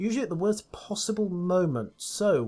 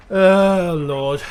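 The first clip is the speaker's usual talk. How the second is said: ordinary talk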